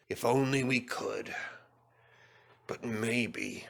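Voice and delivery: soft murmur under the breath; thinking aloud, half to himself; musing to himself. A narrator's reading. Sir Topham Hatt mulling over Gordon's fate, murmuring softly.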